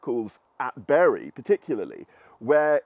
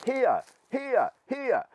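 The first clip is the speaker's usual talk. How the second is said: imitated shout; 11 semitones higher, much slower than usual. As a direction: call out like the severed head, pitched well up. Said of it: "hear a voice shouting very clearly".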